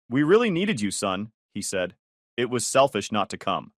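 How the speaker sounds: usual speaking voice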